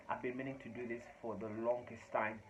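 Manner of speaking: ordinary talk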